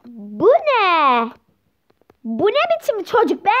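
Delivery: hammed-up appalled outcry. Parent sees the ugly newborn child, appalled. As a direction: pitch leaping up in acted shock.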